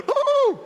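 high hooting bird call; attempted loon call. Shown as a demonstration. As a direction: hoot like a loon, not quite managing it. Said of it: "I don't know how to do it".

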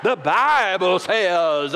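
very slow, stretched Southern drawl; Southern preacher accent. Shown as a demonstration. Showing how we say it in the South, drawn-out Southern accent. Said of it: "that's how we say it in the South".

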